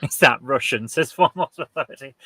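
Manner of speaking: ordinary talk, nothing marked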